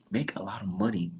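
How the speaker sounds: hushed aside, voice dropped to a whisper. Acted. Ranting about a promoter, whispers the real business model. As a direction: let the secret slip out in a whisper.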